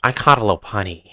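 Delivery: put-on comic villain voice; Jonkler. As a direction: do a villain impression, addressing Batman. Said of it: "I got the Jonkler voice down".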